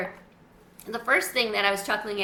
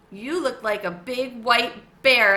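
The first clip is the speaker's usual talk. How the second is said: slower, louder squeaky voice; squeaky hamster; 7 dB louder than usual. A narrator's reading. Humphrey squeaking to Mrs Garcia, slow squeaky. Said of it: "I squeaked".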